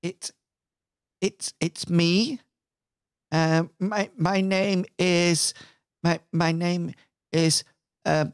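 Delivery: timid caller stammering at the door; Percy. Acted. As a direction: falter through a tentative introduction. GM voices timid visitor at door.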